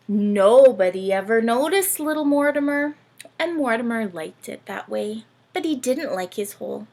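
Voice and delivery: teacher reading a Christmas story to the class; storybook reading. Acted. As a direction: read it like a picture book to little ones. Reading picture book aloud.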